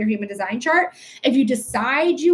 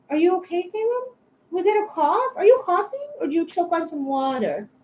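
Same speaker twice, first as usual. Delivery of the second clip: pet talk; 5 semitones higher than usual. Real, not acted. Talking to choking cat.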